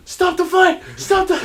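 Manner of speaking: high, loud plea; hammed-up cry to the referee; mock panicked shouting. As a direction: yell like a ringside spectator. Acting spectators at a fight.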